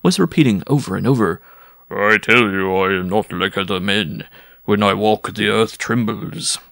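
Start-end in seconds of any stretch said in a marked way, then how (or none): 1.9-6.6 put-on voice of a boastful giant; self-important drunken giant. A narrator's reading. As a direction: boast like a tipsy strongman, slowing at the end. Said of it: "swollen out by his libations".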